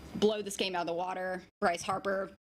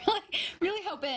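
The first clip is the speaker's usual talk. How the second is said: laughing; 10 semitones higher, much faster than usual. Real, not acted. Laughs while hoping for upset.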